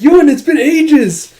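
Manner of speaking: squealed, slow happy greeting; excited greeting. Acted. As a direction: burst out with delight at an old friend. Yona happily greets old friend.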